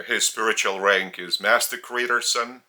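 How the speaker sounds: normal talking voice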